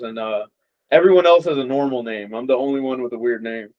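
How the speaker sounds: normal talking voice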